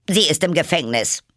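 high, loud commanding queen; evil queen. Acted. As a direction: snap it like a furious queen. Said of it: "sagte die Königin zum Henker". (böse Königin, herrisch)

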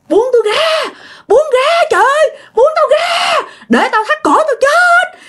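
high, strained struggling yell; acted screaming. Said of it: "gào thét um sùm luôn".

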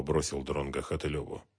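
ordinary delivery, nothing marked

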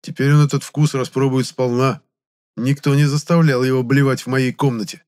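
мстительно, повыше; выговаривай с мстительным нажимом. A narrator's reading. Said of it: "мстительно сказал я". (мстительное негодование)

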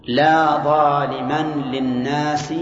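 very slow, deliberate reading of the example. Shown as a demonstration. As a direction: spell the example out slowly for students. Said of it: "مثاله".

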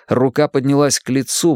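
ordinary delivery, nothing marked

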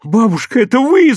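high, breathless question; excited, winded boy's question. A narrator's reading. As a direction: ask it as if out of breath. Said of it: "задыхаясь, спросил Серёжа".

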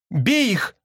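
high cry without much volume; urging cry. A narrator's reading. Sister bird urges her brother on, crying out. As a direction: urge an attack in a high voice. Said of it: "крикнула мне сестра".

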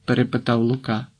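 plain reading voice

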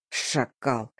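low, hushed hiss; hissed insult. A narrator's reading. Boss curses the man who did it, hissing low. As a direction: hiss it under your breath, soft and low. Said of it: "прошипел хозяин".

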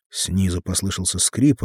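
plain reading voice

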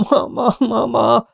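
high, drawn-out wail; helpless high-pitched calling; calling for mother. A narrator's reading. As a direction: cry out slowly for mama, terrified. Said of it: "valaki az édesanyját hívja".

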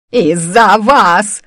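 loud, long-drawn accusation; angry blurt. A narrator's reading. Old woman blaming her captors in anger, angry blurt. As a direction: hurl the blame, dragging out each word. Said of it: "выпалила старуха".

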